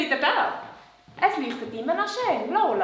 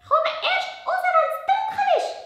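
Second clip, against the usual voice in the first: much higher little puppet voice; 6 dB louder than usual. Acted. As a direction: puppet girl, pitched way up. Puppet Lola answers.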